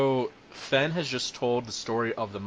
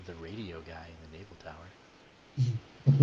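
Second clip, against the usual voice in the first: slow naval tower radio guy; lightly voiced radio operator; 5 semitones lower, slower than usual. Shown as a demonstration. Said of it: "voice I use for".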